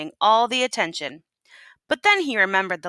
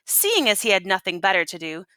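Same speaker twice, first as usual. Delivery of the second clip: usual speaking voice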